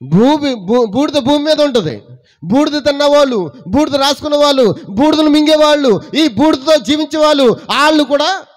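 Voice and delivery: high, loud, ringing preacher's cry; full-force preaching. Acted. Preacher lifts his voice loudly to the congregation. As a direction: proclaim it loudly to the church.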